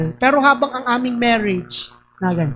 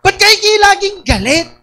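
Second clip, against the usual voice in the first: mock high, louder question; imitating asker; 8 semitones higher, 7 dB louder than usual. Quoting someone who always asks why they are angry, high and loud. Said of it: "lagi niya sinasabihan ako".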